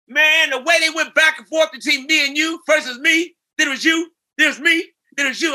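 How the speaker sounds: excited star rattling on about the editing. Retold or imitated. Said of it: "And he said".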